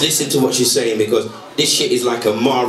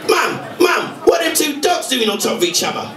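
put-on high, eager child asking; a nosy little boy pestering his mum; 11 semitones higher than usual. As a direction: pipe up like a curious kid. Little boy voiced in joke.

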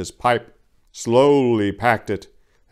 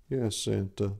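much softer, timid reply; meek; 10 dB quieter than usual. A narrator's reading. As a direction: shrink back and answer. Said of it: "said Prancer meekly".

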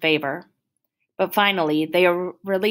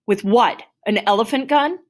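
higher, punchy mocking question; incredulous scoff; 5 semitones higher, 4 dB louder than usual. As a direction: scoff in disbelief, rising at the end. Cop scoffs at medicating him.

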